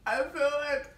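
laughing with a sore mouth; half laughing, half crying. Real, not acted. Mouth burning from sour candy.

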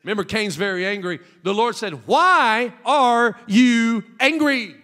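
full-force preaching. Acted. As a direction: stretch the question out big.

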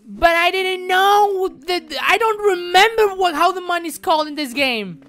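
high, loud whiny fake sob; mock crying whine. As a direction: whimper like a wronged child. Whining at game advice.

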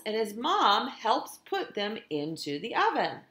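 lively, sing-song storytime reading; animated picture-book narration; reading to children. A narrator's reading. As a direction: read it like a teacher to her class. Teacher reads picture book aloud.

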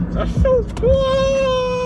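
high, slow, wailing delight; excited. Real, not acted. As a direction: stretch it out in giddy awe. Loopy patient marvels at phone.

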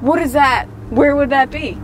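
usual speaking voice

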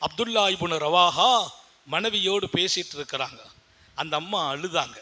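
usual speaking voice

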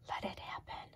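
airy whispered nudge; a breeze whispering softly; breezy whisper. A narrator's reading. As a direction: breathe it like the wind. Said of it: "nudged the breeze".

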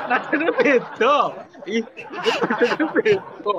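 high, slow, laughing "the tune is different"; laughing insistence. Real, not acted. Insisting to the co-host the melody differs, laughing.

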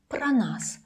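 "Про нас" with о heard as a; pro said as pra. Shown as a demonstration. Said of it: "it sounds like a".

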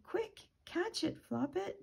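high-pitched urgent mock shout; narrated shout. A narrator's reading. Chicken shouts to Floppet about her chick, high mock shout. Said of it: "Chicken shouted".

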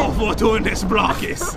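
slow, drawn-out mock incantation; stretched-out wizard's cry; cool spell-casting. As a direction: cry out the spell slowly, trying to sound cool. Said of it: "I sounded cooler that time".

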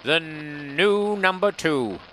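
very slow, drawn-out TV intro voice. Retold or imitated. Recalling the Iron Maiden song's intro, imitated dramatic voice. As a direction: imitate an ominous show opening, stretching it out. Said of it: "Do you remember how that thing went?".

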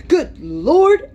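high, loud shocked gasp of a housekeeper; startled exclamation. A narrator's reading. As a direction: blurt it out in sudden shock, voice jumping high. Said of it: "exclaimed poor Missus Medlock".

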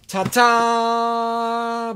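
high, loud held "ta-daa"; loud, drawn-out fanfare; sung-out ta-da fanfare. Pretend. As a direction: trumpet a big reveal. Fanfare for the found box.

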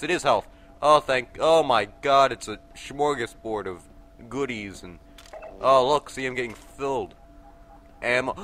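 normal talking voice

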